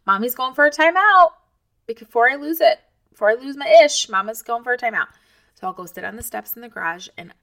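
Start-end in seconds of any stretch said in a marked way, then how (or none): 0.0-5.1 put-on higher, louder call to the kids; loud announcement. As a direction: warn the whole house she's stepping out. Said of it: "I make an announcement".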